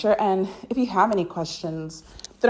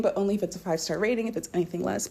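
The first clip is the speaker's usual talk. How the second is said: usual speaking voice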